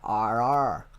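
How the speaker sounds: drawn-out pirate "arr" in a British accent; lightly voiced pirate growl of R; pirate-like R's. Shown as a demonstration. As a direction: lean on the thick R like a pirate. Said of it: "I sound like a pirate".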